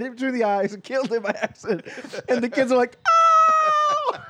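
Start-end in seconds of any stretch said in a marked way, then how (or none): 3.1-4.2 a loud, high "Oh!" of shock; startled shout. Retold or imitated. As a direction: cry out like a kid who just saw something awful. Kids react to shot sea lion.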